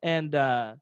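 ordinary talk

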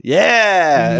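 loud, high, drawn-out yell; enthusiastic shout. Retold or imitated. Streamer accepting a playlist offer, enthusiastic shout. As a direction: imitate an eager streamer shouting back.

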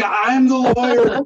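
indignant whine of a green lawyer; petulant child. Retold or imitated. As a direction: insist like a stung young brat. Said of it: "this petulant, you know, child".

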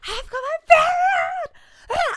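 high, slow, drawn-out joyful yell; thrilled shout. Retold or imitated. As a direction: announce it like huge news. Bursting into sisters' room.

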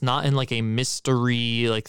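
usual speaking voice

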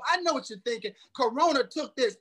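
ordinary talk, nothing marked

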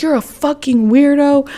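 high mock yell at normal volume; retold screaming. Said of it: "screaming my head off".

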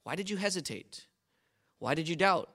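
usual speaking voice

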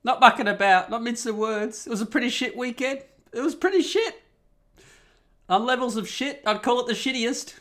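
high, loud, half-laughing rant. Real, not acted. Venting to viewers about his awful weekend, loud laughing rant.

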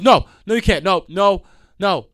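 driver's mock protest; driver refusing. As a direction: mimic the stubborn driver. Driver refusing, retold argument.

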